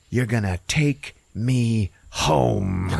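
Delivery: slow, emphatic command; hammed-up forceful demand, drawn out. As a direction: space out each word as an order. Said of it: "gets all forceful with him".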